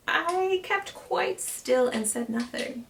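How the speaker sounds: usual speaking voice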